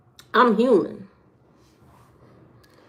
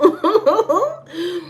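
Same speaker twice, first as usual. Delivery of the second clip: high laugh; 8 semitones higher than usual. Real, not acted. Laugh after no liquor.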